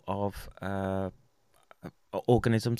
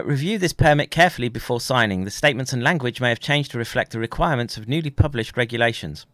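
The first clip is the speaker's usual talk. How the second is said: reading fine print aloud, officially; formal reading; 6 dB louder than usual. Acted. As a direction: read the notice like an official statement. Reads permit text aloud.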